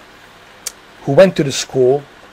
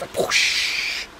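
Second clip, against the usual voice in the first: breathy whoosh like a plane sliding on its belly; airy whoosh of a wheelless landing; whooshing slide noise; 8 dB quieter than usual. Pretend. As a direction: blow out a soft sliding sound.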